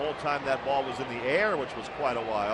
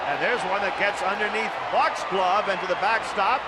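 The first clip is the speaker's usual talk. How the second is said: higher, energized live call; excited play-by-play; 4 semitones higher than usual. Real, not acted. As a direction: call the sudden play as it unfolds. Live call of a passed ball.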